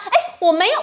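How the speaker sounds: normal talking voice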